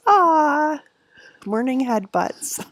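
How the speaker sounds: high cooing aw. Real, not acted. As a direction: coo over the cute dog, slowly. Cooing over the dog.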